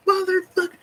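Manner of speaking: high strained curse, retold; imitated high-pitched outburst; mock scream. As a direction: reenact screaming a swear word, held back in volume. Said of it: "he's out there screaming".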